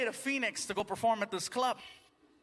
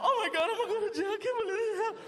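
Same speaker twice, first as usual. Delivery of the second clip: high, rushed panicky babble; hammed-up panic, squeaking fast; freaking out; 11 semitones higher, faster than usual. Driver pulled over by police, high panicked babbling. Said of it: "freaking out".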